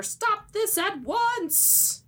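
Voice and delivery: high, slow, imperious interruption; hammed-up heavenly queen, pitched high; commanding queen. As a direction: halt everyone with a drawn-out royal command.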